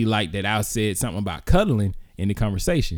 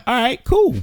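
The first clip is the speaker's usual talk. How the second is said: unbothered; 13 semitones higher, 4 dB louder than usual. Retold or imitated. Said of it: "I was like".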